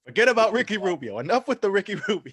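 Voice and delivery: put-on loud, high, fast Brooklyn accent. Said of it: "new Brooklyn accent that you cultivated".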